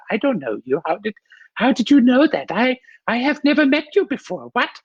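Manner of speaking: very high-pitched, baffled mother; German mom impression. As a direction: play a bewildered German mom questioning a stranger. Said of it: "getting my mom impersonation down".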